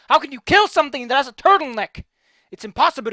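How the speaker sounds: high, loud jokey outburst; hammed-up indignant exclamation; mock excited exclaim. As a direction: sell the joke with overblown enthusiasm. Joking rant about turtlenecks.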